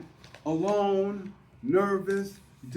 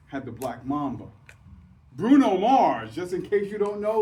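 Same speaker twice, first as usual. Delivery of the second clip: ordinary delivery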